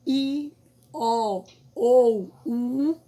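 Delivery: I, O ou U said slowly and loudly, each vowel held; drawn-out vowels. Shown as a demonstration. Teacher to pupils, spelling out the vowels slowly and loudly. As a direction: spell out I, O ou U loud and slow for the class. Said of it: "teacher spells vowels".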